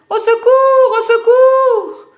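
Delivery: very high, loud drowning scream; cry for help. Acted. Drowning girl screaming for help, very high and loud. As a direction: scream for help as the water takes you. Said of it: "crie la pauvre fillette".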